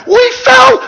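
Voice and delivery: very high, loud hysterical shriek; acted gasping, hooting hysteria; hooting with laughter. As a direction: shout it as if helpless with giggles. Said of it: "she wept and gasped and hooted".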